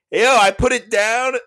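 high, loud shout across the crowd; shouting back. Retold or imitated. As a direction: call it out loud over the music. Retelling own shout to rapper.

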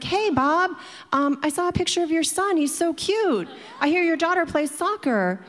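high-pitched, friendly mock small talk; chatty salesman. Salesman making small talk with her brother, high chummy imitation.

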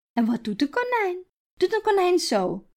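put-on high coaxing voice for a toddler; baby talk. Grandmother quizzing Iris about the rabbit, high baby talk. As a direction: lure the baby into answering.

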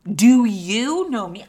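drawn-out, stunned repeat; slowed-down, stunned echo of the question; slow, stretched-out mock disbelief. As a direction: drag it out like you can't believe it. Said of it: "my brain is immediately like".

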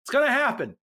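high, helpless protest; making excuses. Retold or imitated. Garibaldi excuses himself to his boss, high defensive protest. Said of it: "Garibaldi makes up an excuse".

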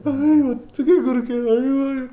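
imitating a tearful mom, high and loud; mom crying. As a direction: mimic a weeping mother at the gate. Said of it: "my mom has the tissues".

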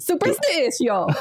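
put-on slow, laughing Italian accent on Superstitio. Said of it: "I wanna say it in an Italian accent".

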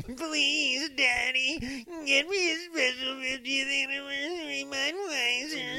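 put-on high pleading child voice; whiny kid voice. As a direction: beg like a little kid. Child voice begging daddy.

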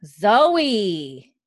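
drawn-out, exasperated name-calling. Real, not acted. Host interrupted by noisy Zoe, exasperated. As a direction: stretch it out in mock-scolding exasperation.

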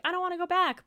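high, whiny-style mock protest; protesting guard. Retelling the guard's refusal to go back, mock protest.

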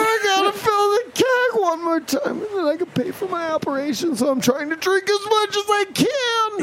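high strained sickly whine. Acted. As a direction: whimper it out, very high. Fireball rep continues voice.

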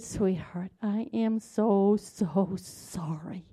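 slow, softening to a near whisper; tender apology. Retold or imitated. Mother kneeling to her little daughter, tender regretful apology. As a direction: apologize slowly and gently, dropping low.

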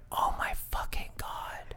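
stunned breathless whisper. Retold or imitated. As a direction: hush it out in shock. Retold shocked reaction, whispered.

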